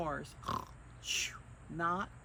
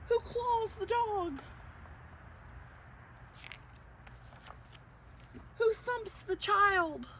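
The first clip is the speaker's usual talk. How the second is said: high, dramatic rising story climax; excited build. A narrator's reading. Reading to children, the sleepers tumble in excited high voice. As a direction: squeal out each step of the chain reaction.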